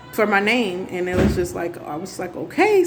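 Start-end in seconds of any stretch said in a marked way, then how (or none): 2.4-2.8 high-pitched, questioning okay; a retold, doubtful okay; puzzled reaction. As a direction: pitch jumps up in mock puzzlement. Retells her puzzled reaction.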